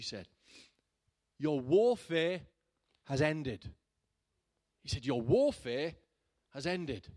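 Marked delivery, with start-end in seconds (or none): 1.4-3.7 "warfare" stretched and loud, slow; proclaimed slowly. Retold or imitated. Preacher retelling the prophetic word to his church. 5.0-7.1 "warfare" stretched and loud, slow; proclaimed slowly. Retold or imitated. Preacher retelling the prophetic word to his church. Repeating the prophecy's key words.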